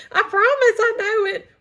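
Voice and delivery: high, loud laughing protest. Real, not acted. Laughing at fumbling the pledge.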